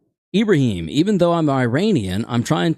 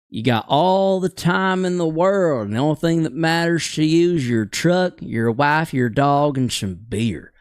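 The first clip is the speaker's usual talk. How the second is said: super Southern accent. Shown as a demonstration. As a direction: lean hard into a laid-back Southern twang. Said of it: "You go super Southern".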